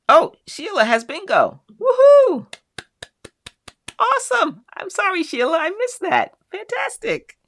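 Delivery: high, loud whoop of delight; bright celebratory shout for a bingo winner; excited cheer. Real, not acted. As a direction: cheer her win like a game-show host. Viewer gets bingo, host cheers.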